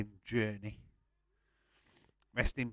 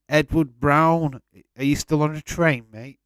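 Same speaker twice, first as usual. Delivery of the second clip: calling out; 6 semitones higher, 11 dB louder than usual. Real, not acted. Greets a viewer by name.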